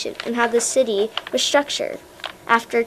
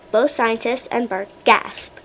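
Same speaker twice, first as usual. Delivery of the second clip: usual speaking voice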